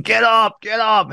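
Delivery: high-pitched mock sideline yell; imitated yelling. Dad yelling at his overmatched kid, imitated. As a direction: imitate an insistent parent yelling, without full volume. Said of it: "he kept yelling".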